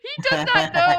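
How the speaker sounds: loud, high, cracking up; helpless laughter at a bad roll; laughing hard. Real, not acted. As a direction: shout it through real laughter. Game master laughs at failed roll.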